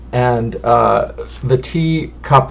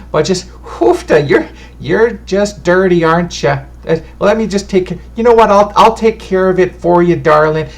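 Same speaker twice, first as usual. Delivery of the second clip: put-on higher, doting old fortune teller fretting over dust; a chatty granny clucking over dirty guests; bustling, motherly old woman voice; fussy old lady; 5 semitones higher than usual. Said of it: "is this little old lady".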